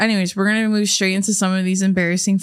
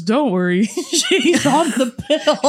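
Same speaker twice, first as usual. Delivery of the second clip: drawn-out, higher punchline through laughter; laughing quote; 5 semitones higher, slower than usual. Retold or imitated. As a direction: stretch the punchline while giggling. Quoting sketch line while laughing.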